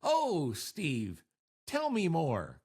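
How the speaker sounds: sarcastic, swooping down on each phrase. Shown as a demonstration. Host repeating the contest phrase for listeners, sarcastic. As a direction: feign keen interest, stretching the words. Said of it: "let's do the sarcasm".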